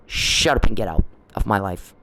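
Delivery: normal talking voice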